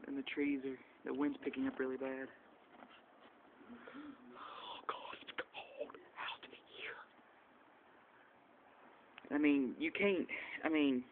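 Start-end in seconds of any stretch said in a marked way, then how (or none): 4.4-7.0 slow, breathless cold whisper; shivering whisper. Real, not acted. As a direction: whisper it through the chill. Freezing in the snowstorm.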